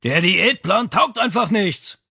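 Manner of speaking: slow, loud shout up the stairs; father yelling up, slow and loud; shouting from below. Acted. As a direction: bellow it up from downstairs.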